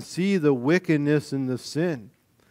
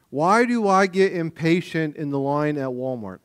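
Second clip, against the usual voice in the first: ordinary delivery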